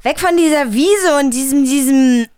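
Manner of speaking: alarmed call to flee; urgent. Acted. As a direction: push everyone to leave now. Fleeing the monster.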